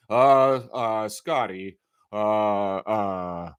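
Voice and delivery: low, slow, mopey stammer; droopy Eeyore mumble; mocking the reporter. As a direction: drag it out like a gloomy reporter. Mimicking Grange's halting question.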